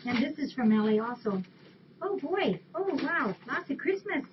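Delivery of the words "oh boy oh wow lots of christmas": high, swooping oohing over a gift; light up with real delight, pitch jumping up; wowed and high-pitched; delighted surprise. Real, not acted. Delighted at toys for the kitties.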